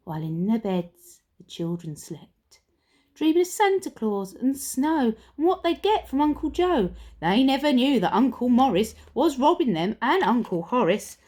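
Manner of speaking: usual speaking voice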